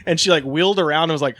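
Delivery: normal talking voice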